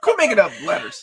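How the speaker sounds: high laughing protest. Real, not acted. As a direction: laugh out a mock complaint. Mock complaint while laughing.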